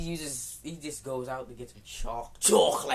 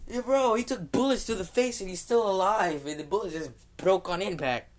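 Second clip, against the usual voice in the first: excited fan; 7 semitones higher, 7 dB louder than usual. Retold or imitated. Excited fans gossiping online.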